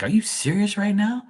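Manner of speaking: high-pitched mock outraged girl; indignant girl, imitated. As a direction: imitate her disbelief, voice shooting way up. Girl thrown out of the car.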